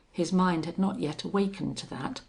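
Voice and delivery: ordinary delivery, nothing marked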